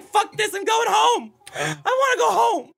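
high, whiny mock give-up; high, whiny Santa quitting; fed-up Santa. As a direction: whine like a beaten man who wants out. Voicing Santa giving up.

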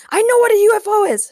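high, loud, fast outburst; exasperated royal yell; shouted interruption. A narrator's reading. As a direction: cut in with an irritated shout. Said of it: "shouted King Ray, cutting him off".

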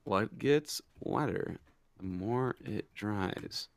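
very slow, low, soft musing; slow recital. Real, not acted. Host typing the riddle into a search, slow quiet recital.

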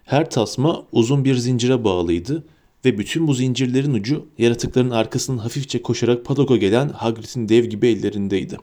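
ordinary delivery

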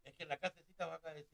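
faint, distant and fast; talking away from the mic; far from mic. Real, not acted. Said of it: "Tienes que hablar al micrófono". (lejos del micrófono)

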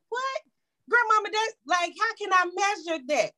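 a high, startled "what?" that settles into a puzzled question; baffled disbelief. Retold or imitated. Granddaughter baffled by grandma's pour-till-the-Spirit recipe, incredulous. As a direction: retell your own shock at a recipe with no measurements. Retold arguing with grandma over measuring.

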